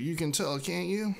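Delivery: ordinary delivery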